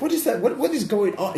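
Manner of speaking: high-pitched, bewildered priest voice; confused priest. Acted. Game master as priest walking into interrogation, confused. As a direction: voice a priest utterly baffled by the scene. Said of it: "He is really confused".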